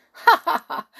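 high, loud jeering ha ha ha; mocking laugh. Goblin taunts the bottled wizard with a loud jeering laugh. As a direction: laugh in the trapped wizard's face. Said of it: "said the goblin".

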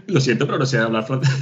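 repeating the apology while laughing; laughing apology to the French; laughing through it. Retold or imitated. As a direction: giggle through the line.

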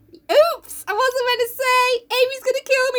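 high, drawn-out mock guilt; mock oops. Laurie letting slip his marriage to Jo, high mock oops. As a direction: squeak out a hammed-up guilty confession, taking your time.

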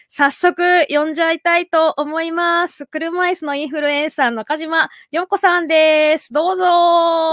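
bright-pitched, drawn-out welcome call; slow, rising announcer-style intro; guest introduction. Real, not acted. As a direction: stretch out the words to bring on the guest. Host calls the guest on stage.

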